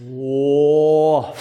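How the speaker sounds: drawn-out amazed whoa; stunned wow; awed whoa. Shown as a demonstration. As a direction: let the awe out in one long breath. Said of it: "will make you go".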